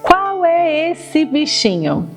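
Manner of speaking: put-on slow, sing-song toddler voice; reading to a toddler. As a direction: draw out each word for little kids. Quiz with toddlers about animals.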